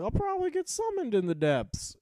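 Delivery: sudden optimism about being summoned; squeaky hopeful. Retold or imitated. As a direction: lift it up into a bright little hope. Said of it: "I was like".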